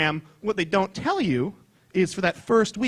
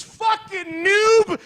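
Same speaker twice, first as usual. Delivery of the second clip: high, drawn-out gamer yell; hammed-up high trash-talk shout; mock taunting shout; 11 semitones higher, 3 dB louder, much slower than usual. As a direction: yell it slowly to throw off a rival. Gymnast trash-talks rival mid-routine.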